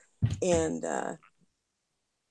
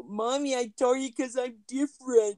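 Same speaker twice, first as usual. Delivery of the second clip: raised-pitch mock apology; pleading son act; 6 semitones higher than usual. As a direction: imitate a kid putting on a pitiful act for his mother. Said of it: "He go".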